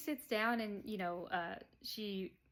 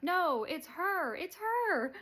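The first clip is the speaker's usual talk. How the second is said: much higher, louder, slowed realization; mock horrified gasping realization; dawning horror; 10 semitones higher, 6 dB louder, much slower than usual. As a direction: stretch it out as the truth hits. Dottie realizing Beverly's the killer.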